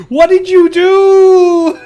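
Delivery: high, loud, drawn-out cry of disbelief; exclaiming wail. Real, not acted. Player reacting to a friend's audio mishap, high wail.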